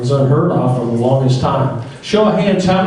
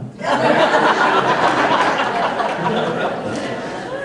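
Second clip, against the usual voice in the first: a long breathy laugh after the punchline; can't help cracking up at his own joke; 9 semitones higher, 4 dB quieter than usual. Real, not acted. Emcee laughing at his joke for the banquet crowd, long breathy laugh.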